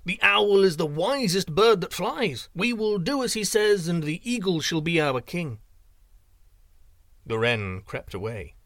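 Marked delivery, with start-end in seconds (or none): 0.0-5.6 birds agreeing. A narrator's reading. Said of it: "cried the other birds".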